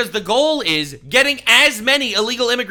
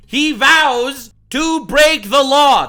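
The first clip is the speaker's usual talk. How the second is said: slow, loud, high "break the law"; hammered emphasis; 6 semitones higher, 9 dB louder, much slower than usual. Real, not acted. Commentator translating a politician's promise, hammering "break the law". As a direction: draw out "vows" and "break the law" loudly.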